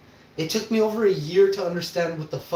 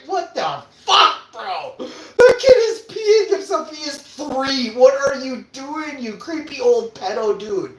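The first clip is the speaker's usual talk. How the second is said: high, loud indignant yell, slowed; outraged retort; 10 semitones higher, 6 dB louder, slower than usual. Retold or imitated. Telling off the creepy guy scaring a toddler, outraged yell. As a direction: blast back at the bully in disbelief. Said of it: "I'm like".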